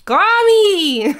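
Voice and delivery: high, loud flirty call. Pretend. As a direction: shout it like an invitation. Playful flirty shout.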